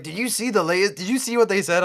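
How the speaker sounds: high, eager mocking voice; mocking quote. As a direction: imitate breathless news junkies. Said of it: "every time someone's like".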